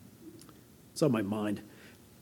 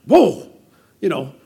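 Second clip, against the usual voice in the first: higher, louder, startled retelling; mock shock; 4 semitones higher, 6 dB louder than usual. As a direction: act out a startled patient's outburst. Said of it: "We're just shocked. Like".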